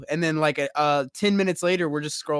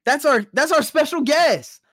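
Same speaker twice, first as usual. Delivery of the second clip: hype announcer; 7 semitones higher, 4 dB louder than usual. Acted. Introducing the special guest.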